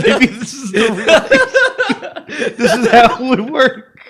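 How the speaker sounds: high laughing excitement. Real, not acted.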